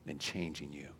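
ordinary talk, nothing marked

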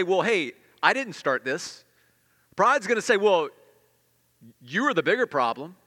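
pride's excuse. Retold or imitated. Pride excusing itself in a quarrel, loud and defensive. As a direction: protest your innocence.

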